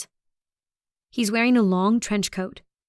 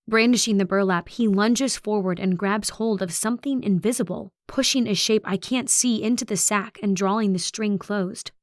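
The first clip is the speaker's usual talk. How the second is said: ordinary delivery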